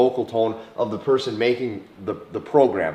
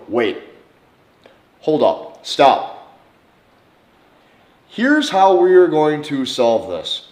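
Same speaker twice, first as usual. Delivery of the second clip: louder, firmer slowing down; calm but firm; 3 semitones higher, 6 dB louder, slower than usual. Shown as a demonstration. Halting an argument with parents, firm and louder. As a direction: take control of the argument, firm. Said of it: "in an argument with your parents".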